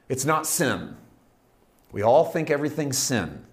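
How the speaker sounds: ordinary delivery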